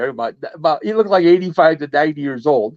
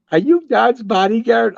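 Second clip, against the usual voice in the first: curious woman; 9 semitones higher than usual. Retold or imitated. Woman asks teller a question.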